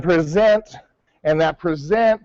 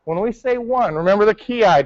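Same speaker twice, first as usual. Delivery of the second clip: normal talking voice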